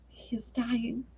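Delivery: hushed unvoiced whisper. Children by the deathbed whisper to one another, hushed. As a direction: breathe it out, no voice. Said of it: "They whispered to one another".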